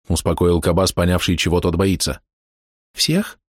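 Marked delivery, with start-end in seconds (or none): 2.9-3.4 high, startled question; surprised boy. A narrator's reading. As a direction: ask it in surprise. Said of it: "удивлённо переспросил мальчишка".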